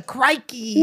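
hammed-up Aussie exclamation; Australian accent. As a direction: throw it out in a mock Australian accent.

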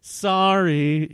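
high, loud, stretched-out cry; yelled apology. A narrator's reading. Drew apologizing to Ian, yelling it. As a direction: yell it back across a distance. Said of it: "yelled Drew".

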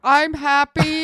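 high, slow, dopey "I'm happy"; mock dim husband. Teasing her husband who can name only two feelings, in a high, slow, dopey voice. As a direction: play it simple and a bit dumb.

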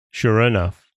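ordinary delivery, nothing marked

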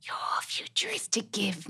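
slow mystic whisper. Acted. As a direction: slowly whisper the secret.

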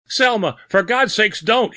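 very high, desperate plea; panicked, gasped begging; terrified gasp. A narrator's reading. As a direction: beg a ghost for mercy. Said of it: "he gasped".

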